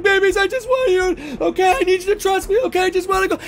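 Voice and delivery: high, strained, urgent plea; frantic pleading. Acted. Lori begs her family in the speeding car, frantic. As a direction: plead in a panic, pitched far up.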